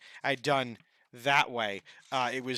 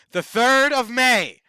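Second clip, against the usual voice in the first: slow, loud and high, each word landed; host locking in the event date, slow and loud; emphatic announcement; 7 semitones higher, 8 dB louder, slower than usual. Real, not acted. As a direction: proclaim the date like a big reveal.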